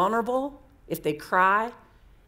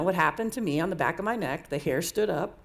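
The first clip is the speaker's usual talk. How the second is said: usual speaking voice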